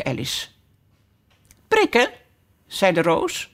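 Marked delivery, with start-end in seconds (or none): 1.7-2.1 a touch of squeaky character voice; short high-pitched reply; high flower voice. A narrator's reading. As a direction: pipe it up high. Rose answers in flowers' quick chorus.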